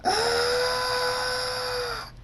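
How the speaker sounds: very high, sustained scream; long scream. A narrator's reading. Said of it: "Thunderstorm screams".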